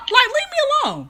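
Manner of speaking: high, fast mock plea to be left alone; fast high-pitched fed-up outburst; exasperated squeal. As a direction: squeal it out like you're fed up with him. Annoyed at nagging DM guy.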